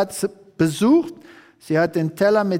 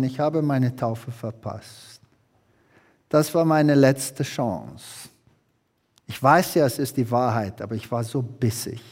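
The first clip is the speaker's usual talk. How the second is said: remorseful confession; 6 semitones lower than usual. Retold or imitated. Husband confesses to the preacher he missed his baptism, low and remorseful.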